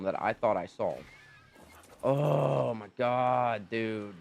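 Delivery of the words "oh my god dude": very slow, drawn-out oh my god; weary groan. Real, not acted. Gamer groans over losing his Deodicarus, weary. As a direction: groan it out, defeated.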